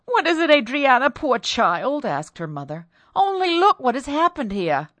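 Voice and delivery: high, loud worry; anxious mother. Acted. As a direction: fret over her child. Mother comforts frightened daughter.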